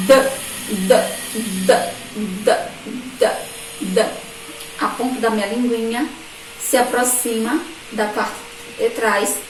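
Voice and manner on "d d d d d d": "D" sounded as a short "duh", not "dê"; letter's phoneme. Shown as a demonstration. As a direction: tap out each "D" as a brief isolated d sound. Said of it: "a letra D representa o foneminha".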